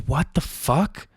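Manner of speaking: slow, high mock disbelief; stunned husband, high and stretched out; incredulous. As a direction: draw it out in acted disbelief, pitch climbing. Husband repeats his stunned reaction.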